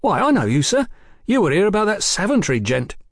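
mild surprise. Acted. The boy recognises Fraser.

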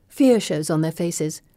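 ordinary delivery, nothing marked

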